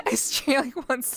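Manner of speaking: helpless real laughter through the words; laughing. Real, not acted. Streamer laughing at their own once-a-week schedule, cracking up.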